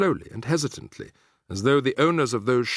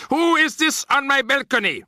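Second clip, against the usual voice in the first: witch snapping; 6 dB louder than usual. A narrator's reading. Grand High Witch demanding who calls, sharp witch voice. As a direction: snap it out like a haughty witch.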